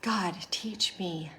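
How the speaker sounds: slow, hushed plea; whispered prayer. Retold or imitated. Woman retelling her prayer to God, slow and whispered. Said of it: "as I was praying".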